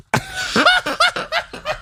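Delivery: high giggling cackle; real laughter. Real, not acted. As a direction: laugh helplessly, high and light. Laughing at own remark.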